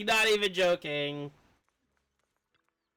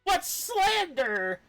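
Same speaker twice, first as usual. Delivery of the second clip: slow, high, hammed-up wail; mock wail; 13 semitones higher, slower than usual. Streamer voicing a wronged character, mock wailing. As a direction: draw it out as a whiny cry.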